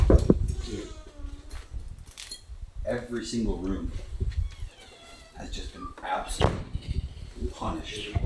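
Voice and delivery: breathless sultry whisper; husky seductive whisper. Acted. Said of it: "Why are you using your sexy voice?".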